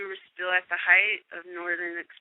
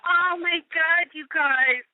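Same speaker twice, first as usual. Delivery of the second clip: excited gush; 9 semitones higher, 4 dB louder, slower than usual. Retold or imitated. Retold gushing to friends.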